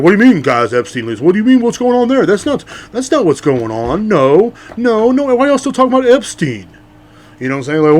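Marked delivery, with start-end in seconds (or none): 0.0-6.7 high, loud mocking Trump voice, playing dumb. Mocking Trump dodging the Epstein list questions. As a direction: play innocent and dodge, loud and high. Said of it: "he's like".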